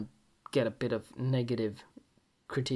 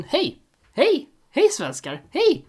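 much higher, louder cheerful calls; excited greeting; 12 semitones higher, 9 dB louder, slower than usual. Real, not acted. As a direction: shout out to Swedish fans with glee. Greeting Swedish listeners excitedly.

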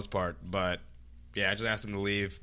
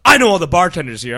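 loud angry shout; 1 angry customer yelling; 7 semitones higher, 15 dB louder, faster than usual. Retold or imitated. Drunk guest cursing out the bartender, shouted. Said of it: "And he's just like".

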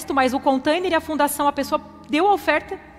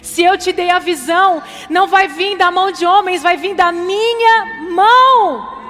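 louder, higher, slow preaching cadence; full-force preaching; 5 semitones higher, 6 dB louder, slower than usual. Acted. Pastor exhorting the congregation to trust God, loud and slow. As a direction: preach it out with rising fervor.

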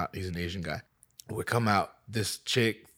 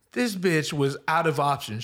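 ordinary talk, nothing marked

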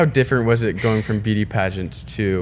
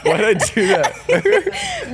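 laughing; 10 semitones higher, 3 dB louder, much slower than usual. Real, not acted. Laughing at her own slip.

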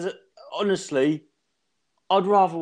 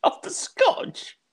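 incredulous; much slower than usual. Real, not acted. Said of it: "incredulous repeat of S1's word".

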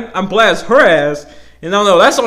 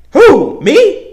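high, louder mock disbelief; hammed-up who, me, playing innocent; incredulous; 7 semitones higher, 6 dB louder than usual. Retelling being accused at work, high incredulous who me.

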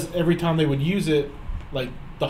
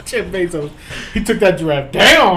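louder and laughing through the words; 3 semitones higher, 6 dB louder, slower than usual. Real, not acted. Joking to friends that Bezos hunted the giraffe, laughing. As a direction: crack up as you say it.

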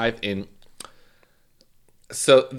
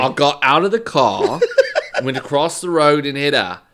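loud, higher mock-British accent; 4 semitones higher, 7 dB louder than usual. Podcaster reading killer's statement, mock British accent. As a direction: put on a British accent, half laughing. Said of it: "here's the British accent for you".

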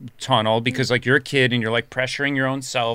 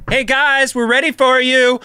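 calling out; 11 semitones higher, 6 dB louder than usual. Retold or imitated. Crew calling them to set.

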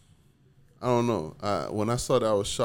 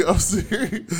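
louder and higher through real laughter; laughing; 9 semitones higher, 6 dB louder, much slower than usual. Real, not acted. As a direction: insist while cracking up. Laughing at the stroke check.

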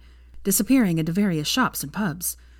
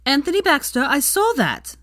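high, loud call across the crowd; sudden shout; 9 semitones higher, 5 dB louder than usual. A narrator's reading. Teacher catching a boy pulling pigtails, shouting. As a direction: bark it at the boy. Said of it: "she shouted suddenly".

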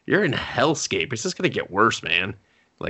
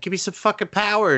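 high, rushed mock demand; imitation de reine exigeante; 10 semitones higher, faster than usual. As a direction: play the queen urgently demanding power, hammed-up. Retelling a queen's frantic prayer.

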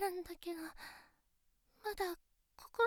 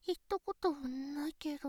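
whispered aside; 6 semitones lower, 7 dB quieter than usual. Acted. Shy admission mid-pout.

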